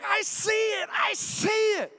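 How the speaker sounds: put-on high squealing kid shout; excited child. Father imitating his excited daughter spotting an angel. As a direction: shout it like a thrilled little girl. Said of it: "she's like".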